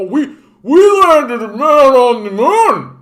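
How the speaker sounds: very high, loud, drawn-out crowing; hammed-up whooping patriot caricature; mock American braggart. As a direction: holler the boast like a dim patriot.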